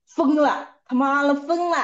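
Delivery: wife imitates husband cursing her as crazy, mildly angry; imitating husband's angry cursing. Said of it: "骂开我".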